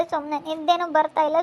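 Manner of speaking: normal talking voice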